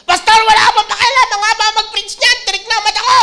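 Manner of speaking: high, loud hammed-up complaint; mock complaining yell. Congregant yelling at the pastor about long preaching, hammed-up. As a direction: whine loudly at the pastor, laughing.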